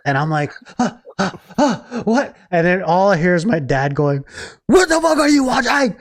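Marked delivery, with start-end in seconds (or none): 0.8-2.3 high gasps of mock confusion; startled awake. Shaken awake by his dad. 4.7-6.0 high-pitched mock yell; worked-up, high demand; furious dad. Dad shakes him, demanding answer.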